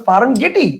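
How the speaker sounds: ফার্ম গেটি with an extra "ee" ending; spelling pronunciation. Shown as a demonstration. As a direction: say গেটি as "ge-tee". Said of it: "actual বানান অনুসারে উচ্চারণ করি".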